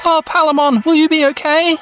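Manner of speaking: put-on high, girlish concern. Mimi asks Palmon if she'll be okay, concerned. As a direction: ask it high and worried.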